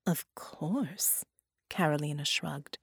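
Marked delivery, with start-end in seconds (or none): 0.1-1.2 whispering. A narrator's reading. Villain shrugs off question.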